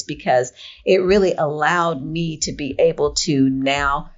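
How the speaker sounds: ordinary talk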